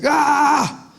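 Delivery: high frustrated "ah"; mock rage yell. Man stuck in his car, yelling in mock rage. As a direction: let out a hammed-up cry of fury. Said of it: "my fatigue turned into rage".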